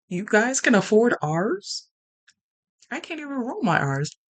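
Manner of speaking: high, loud mock indignation; mock outrage. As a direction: act scandalized, loud and high. Mock outrage about R's.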